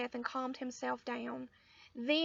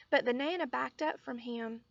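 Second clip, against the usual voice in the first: ordinary delivery, nothing marked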